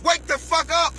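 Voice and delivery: loud, high yell to wake them up. Real, not acted. Yelling at viewers to wake up, loud and high. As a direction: shout it out at the viewers.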